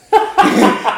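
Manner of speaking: a big, high laugh bursting out; loud burst of laughter. Real, not acted. Cracking up while telling the interviewer a story.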